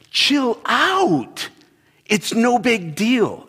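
high-pitched, stretched-out mock exasperation; hammed-up high, drawling brush-off; exasperated dismissal. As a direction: act out waving a worry away, pitch way up. Acted quarrel, dismissing wife's worry.

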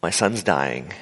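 low, subdued, desperate plea; pleading father. Acted. Father begging Jesus for help.

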